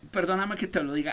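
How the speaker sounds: usual speaking voice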